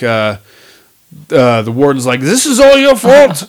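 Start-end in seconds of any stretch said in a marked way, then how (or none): 2.2-3.5 angry warden voice. Retold or imitated. Warden quote in movie retelling.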